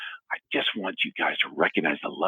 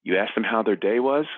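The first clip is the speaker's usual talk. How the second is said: normal talking voice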